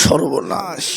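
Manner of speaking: low, soft horrified whisper of dismay; startled gasp of dismay. Acted. Headmaster startled by the boy, low soft dismay. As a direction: gasp it out, shocked. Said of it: "চমকে উঠে বলছে".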